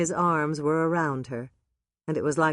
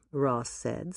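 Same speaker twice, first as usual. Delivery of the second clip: ordinary talk, nothing marked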